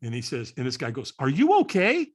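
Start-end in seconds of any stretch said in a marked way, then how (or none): 1.2-2.1 higher, louder acted question; acted-out stranger checking in; concerned stranger. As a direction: call out a concerned check on someone.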